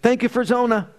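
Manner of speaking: high, loud joyful thanksgiving; fervent prayer. Retold or imitated. Father praising God on his knees for his daughter. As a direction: pour out thanks to the Lord with fervor. Grace at breakfast.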